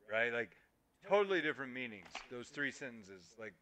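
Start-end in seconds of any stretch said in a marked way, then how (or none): none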